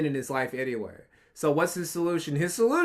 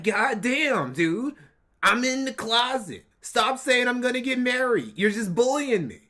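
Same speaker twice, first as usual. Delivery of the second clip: exasperated whine, pitched up; mock frustrated Nick, higher; 6 semitones higher than usual. Co-host voicing Nick fed up with his fans, exasperated whine. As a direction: play him fed up with fans.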